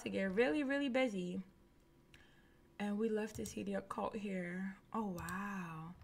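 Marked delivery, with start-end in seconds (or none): 2.8-5.9 breathy whispered wow. Real, not acted. Vlogger whispering awe at occult items. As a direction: hush it in awe.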